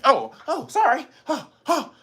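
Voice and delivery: high, startled mock apology. Scared person after hearing excuse me, mock startled. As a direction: gasp out apologies in feigned fright.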